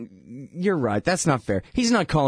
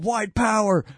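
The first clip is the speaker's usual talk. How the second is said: mild mock yell, pitched higher; mock shout from crowd; 8 semitones higher, slower than usual. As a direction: call it out like a rally heckler, half-hearted. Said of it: "somebody's shouting out".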